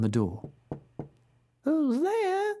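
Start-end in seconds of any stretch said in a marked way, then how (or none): 1.6-2.5 much higher croaky fake granny; croaky grandmother voice. A narrator's reading. Wolf in bed faking grandmother, high and croaky. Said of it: "best croaky grandmother voice".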